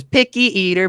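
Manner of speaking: teasing singsong jab; mocking taunt. [name] teasing Haskin, mocking singsong taunt. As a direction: needle him like a smug brother. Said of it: "points at him and laughs and says".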